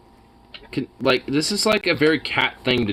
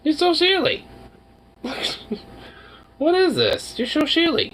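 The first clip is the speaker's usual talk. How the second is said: high squeaky pet voice, much higher; 13 semitones higher than usual. Real, not acted. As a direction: coo at the cat in a tiny high voice. High baby talk to cat.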